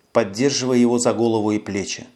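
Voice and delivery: usual speaking voice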